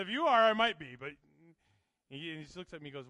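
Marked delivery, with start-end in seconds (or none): 0.0-1.0 sudden loud quip. Retold or imitated. Joking reply in story.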